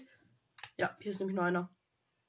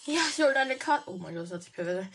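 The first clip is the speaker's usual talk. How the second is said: ordinary talk, nothing marked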